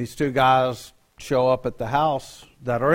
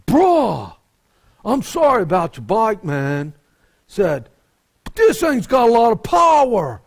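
high, louder surfer-dude apology; West Coast airhead voice; 7 semitones higher, 5 dB louder than usual. Retold or imitated. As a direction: play the sheepish surfer dude, pitched up and loud. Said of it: "West Coast airhead talk".

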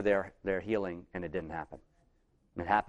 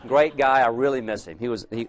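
ordinary talk, nothing marked

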